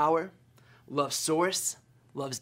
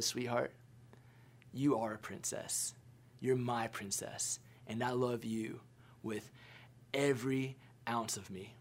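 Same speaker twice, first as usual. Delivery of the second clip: tender reassurance; 4 semitones lower, 9 dB quieter than usual. Retold or imitated. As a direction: hush it like soothing a crying child. Dad reassures tearful four-year-old.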